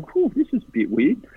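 high-pitched mock unease; nervous retelling. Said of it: "just getting really nervous".

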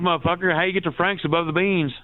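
ordinary talk, nothing marked

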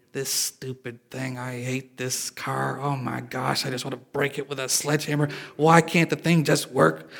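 low-key, quiet venting at a car; mock-frustrated grumbling, very soft; muttered frustration. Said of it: "when you're frustrated".

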